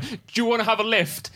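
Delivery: high-pitched, panicked offer. Retold or imitated. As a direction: blurt it out high, retelling your own panic. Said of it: "I panicked".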